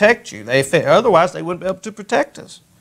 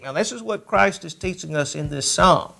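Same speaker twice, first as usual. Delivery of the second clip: usual speaking voice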